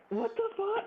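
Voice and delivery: retelling the shoe shiner's trick, incredulous high yelp; shocked outrage. Retold or imitated. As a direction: voice jumps way up in disbelief. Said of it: "I'm like".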